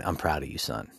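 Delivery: low, soft fatherly line; proud father. Retold or imitated. Gorilla dad to his son after escaping prison, quietly proud.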